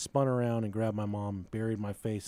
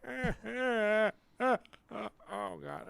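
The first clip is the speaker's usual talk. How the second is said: mock sobbing; 11 semitones higher, slower than usual. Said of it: "I'm like".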